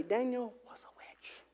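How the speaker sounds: hushed gossipy church-lady whisper; church gossip voice. Shown as a demonstration. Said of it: "in your, like, church gossip voice".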